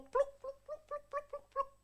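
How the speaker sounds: very high, quick pluk pluk pops; popping sound effect. Retold or imitated. Preacher mimicking tubes pulled off his body, with high popping sounds. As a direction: pop each pluk out like a tube coming loose.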